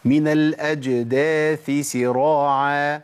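من الأجداث سراعا slow, الأجداث ending in a clear th; تلاوة صحيحة; correct recitation. Shown as a demonstration. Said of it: "عشان ما أجيبش إيه سينين أو ثائين".

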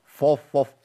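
فف said thick and heavy; heavy f drilled. Shown as a demonstration. Teacher isolates the wrong thick f. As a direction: repeat فف with a dark f. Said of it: "ما تنفعش".

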